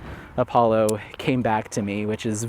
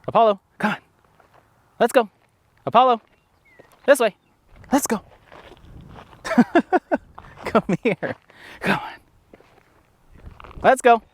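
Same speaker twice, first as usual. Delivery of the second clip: calling the dog; 10 semitones higher than usual. Real, not acted. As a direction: call him back across the park. Calling dog back at park.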